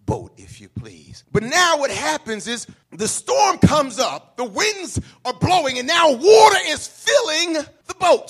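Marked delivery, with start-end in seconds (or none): 1.3-8.3 loud, rising storm-telling shout; full-force preaching. Acted. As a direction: build the storm for the congregation.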